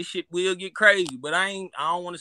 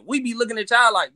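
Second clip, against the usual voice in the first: normal talking voice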